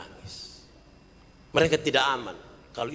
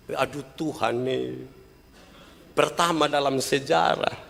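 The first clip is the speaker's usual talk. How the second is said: ordinary talk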